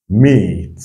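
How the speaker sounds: MEAT alone with a long, falling ee; drawn-out long vowel. Shown as a demonstration. Teacher repeating for learners, MEAT drawn out. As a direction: hold the ee in MEAT before the t. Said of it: "On doit un peu tirer là".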